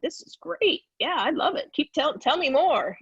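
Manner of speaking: high, eager and thrilled; gushing teen excitement. Retold or imitated. A sixteen-year-old gushing at recruiting coaches, eager and excited. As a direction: soak up the flattery like a starstruck sixteen-year-old.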